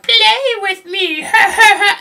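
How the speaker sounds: very high, loud creepy doll laugh; high creepy doll voice with an eerie laugh. Retold or imitated. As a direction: cackle like a haunted doll toy. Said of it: "It's like".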